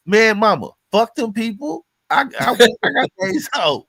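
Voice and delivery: brushing off mama's worries, laughing; defiant outburst. Retold or imitated. Son brushing off his mama's nagging, loud laughing protest.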